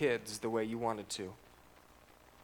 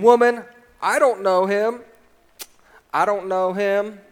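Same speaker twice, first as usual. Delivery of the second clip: loud, insistent denial with stress on don't; preacher voicing Peter's denial loud, don't stressed; emphatic denial; 5 semitones higher, 11 dB louder than usual. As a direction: Peter flatly denying, loud and firm. Peter denies knowing Jesus.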